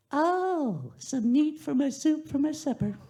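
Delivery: very high, pleased exclamation; old woman delighted. Acted. Old lady holding up the toe, high delighted voice. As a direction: relish the find, very high.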